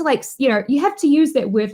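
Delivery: ordinary delivery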